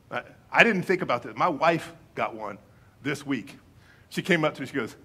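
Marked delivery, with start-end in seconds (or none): none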